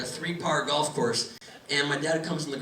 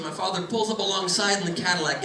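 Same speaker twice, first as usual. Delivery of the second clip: ordinary talk, nothing marked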